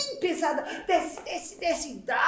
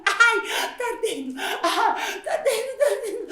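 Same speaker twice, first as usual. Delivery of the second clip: higher laughing whine; stinging eyes; 6 semitones higher than usual. Acted. Mother with soap in her eyes, higher laughing whine.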